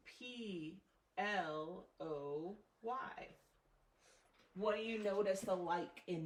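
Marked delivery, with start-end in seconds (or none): 0.1-3.3 each letter drawn out slowly with pauses; careful dictation of letters; slowly spelled out. Shown as a demonstration. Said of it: "Take a look at these words".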